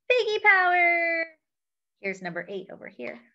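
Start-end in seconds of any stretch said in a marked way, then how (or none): 0.1-1.3 higher, louder little bird cheer. Acted. Bird cheering the superpowered piggies, higher louder cheer. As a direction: cheer it like a tiny superhero fan. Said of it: "The bird says".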